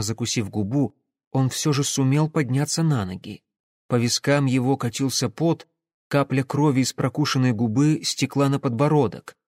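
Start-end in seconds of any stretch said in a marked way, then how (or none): none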